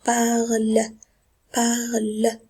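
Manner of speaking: "parle" drawn out slowly as one syllable; silent -ent ending. Shown as a demonstration. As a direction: model "parle", stopping on the l. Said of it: "On doit le prononcer".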